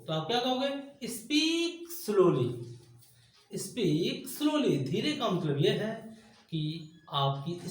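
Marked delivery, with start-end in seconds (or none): 1.1-4.8 drawn-out teaching read. Shown as a demonstration. Teacher models the English phrase.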